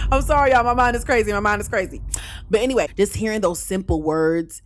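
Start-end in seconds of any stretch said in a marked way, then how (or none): 0.1-2.0 loud, high, through laughter; laughing apology. Real, not acted. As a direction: apologise while still cracking up. Apologises while cracking up.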